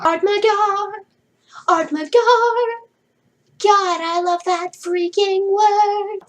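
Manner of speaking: high, drawn-out singsong; mock swooning. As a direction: stretch it out high, gushing over a beloved word. Gushes playfully over favourite word.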